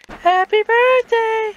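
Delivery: loud, high, slow birthday cheer; excited. Real, not acted. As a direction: cheer it out, drawn out. Birthday greeting to sister.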